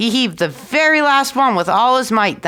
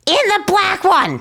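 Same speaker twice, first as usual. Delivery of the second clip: put-on much higher little-boy answer; tiny boy voice; 10 semitones higher than usual. A narrator's reading. Tom Thumb answering the innkeeper, high tiny voice. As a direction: pipe up small and high.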